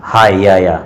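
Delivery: a lightly voiced shout from the crowd under the tamarind; calling out. A narrator's reading. Villagers calling others to the tree, mild shout. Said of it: "मग एकदम ओरड झाली".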